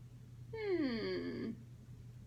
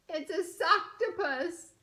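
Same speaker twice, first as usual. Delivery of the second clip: big reveal; 5 semitones higher, 6 dB louder than usual. Acted. Punchline reveal read aloud.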